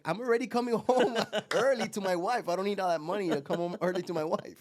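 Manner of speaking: high, loud comic protest; exasperated retort. Retold or imitated. Fisherman tells Wall Street guy he needs no money, exasperated retort. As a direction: protest loudly as the baffled fisherman.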